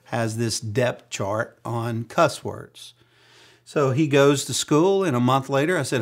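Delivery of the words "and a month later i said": ordinary talk, nothing marked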